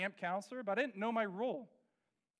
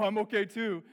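sarcastic hurt; 8 dB louder than usual. Retold or imitated. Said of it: "resist the urge of".

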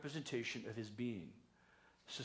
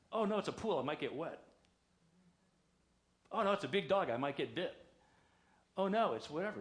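higher, louder mock worry on each "oh, no"; hammed-up timid parent making excuses; mock fretting; 3 semitones higher, 6 dB louder than usual. Mock hesitant parents fretting over danger.

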